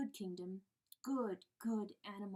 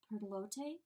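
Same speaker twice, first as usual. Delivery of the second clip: plain reading voice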